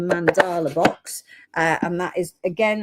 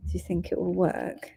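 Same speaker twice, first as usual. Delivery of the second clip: whispering; 7 dB quieter than usual. Real, not acted. Testing earpiece mid broadcast.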